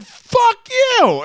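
very high, loud screech of retold outrage; mock furious yell. Host voices wife's aunt cursing him back, high mock-furious yell. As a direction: imitate an outraged relative yelling it. Aunt's angry reply in retold quarrel.

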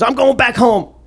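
high, huffy retort; lightly voiced angry Beetlejuice; mock angry little man. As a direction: voice him storming off in a huff. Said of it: "He goes".

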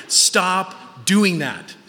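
very slow, drawn-out order; slow, emphatic scolding of the kids; parental nagging. Retold or imitated. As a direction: stretch each word out like a weary-of-repeating parent. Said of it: "I say it to my kids every day".

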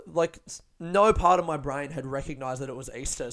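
ordinary talk

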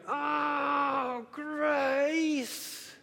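high, loud shouted call of a name; yelling his sister's name across the house; child's shout. Retold or imitated. Son yelling at sister after a fall, high loud shout. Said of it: "Reuben going".

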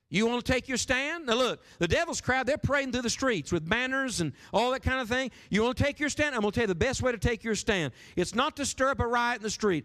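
rallying the church to speak up, fervent preaching. Acted. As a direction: preach it hard to the crowd. Preaching at full force.

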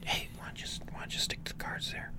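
unvoiced whisper, mock handler aside. Co-host whispering the handler's aside to Tony. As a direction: hiss it under the breath to Tony.

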